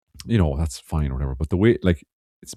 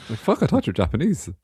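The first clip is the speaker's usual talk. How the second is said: higher, a hint of a Dublin tough; lightly voiced Dublin tough guy; mock Dublin hard man; 10 semitones higher than usual. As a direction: play a Dublin hard man taken aback. Voicing a Dublin-accented Japanese guy.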